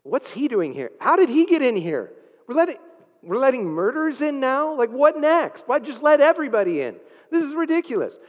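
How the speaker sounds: high, loud mock-outraged gatekeeper; indignant complainer. Preacher playing a heavenly objector, hammed-up outrage. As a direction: squawk in disbelief at who got in. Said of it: "join me just in a little bit of foolishness".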